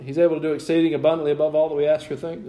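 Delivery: rattled-off verse, fast; hurried recital from memory; quick checking aside. Real, not acted.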